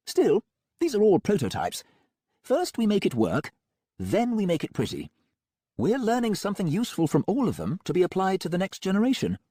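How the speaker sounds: breezy, untroubled villain doctor; Melmoth voice. A narrator's reading. Melmoth explains prototypes.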